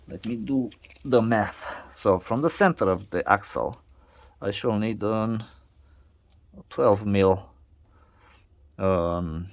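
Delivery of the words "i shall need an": usual speaking voice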